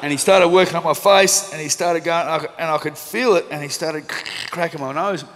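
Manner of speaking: can't help laughing at the memory; chuckling through the pain story. Real, not acted. Laughing while recalling painful doctor.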